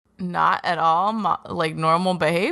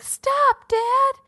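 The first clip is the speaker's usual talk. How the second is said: pleading son; much slower than usual. Retold or imitated. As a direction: beg your dad to stop, much higher. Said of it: "his son would be like".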